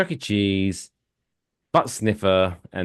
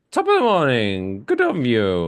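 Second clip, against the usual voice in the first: mock Irish accent; 2 semitones higher than usual. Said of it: "an Irish brewery has gone".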